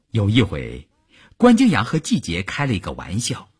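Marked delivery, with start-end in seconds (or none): none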